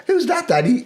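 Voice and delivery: high, questioning little line as the film's daughter; lightly voiced daughter. Co-host imagining what Jenny should ask her father, high questioning voice. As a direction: pitch it up for a child's question. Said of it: "she doesn't go".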